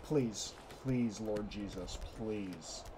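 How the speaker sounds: low, slow, very soft plea; hushed, low begging; quiet pleading prayer. Real, not acted. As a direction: pray softly and slowly for help. Praying before a scary jump.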